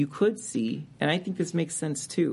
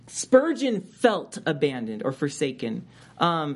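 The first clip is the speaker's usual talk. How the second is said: ordinary talk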